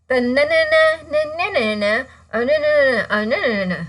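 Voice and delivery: high, fast singsong na na na; mock a droning speaker with one tune; monotonous sing-song. Shown as a demonstration. Teacher mocking a monotonous speech delivery, singsong. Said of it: "Never deliver in a monotonous voice".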